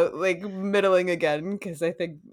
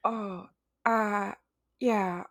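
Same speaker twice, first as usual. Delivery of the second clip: Jennifer Coolidge impression; slower than usual. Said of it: "my impression of Jennifer Coolidge if she were a virgin sacrifice".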